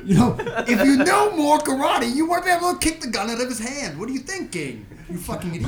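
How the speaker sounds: exasperated mock rant, high and loud, easing off; mock scolding dad. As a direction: berate the kid, hammed-up. Dad berating son in a bit.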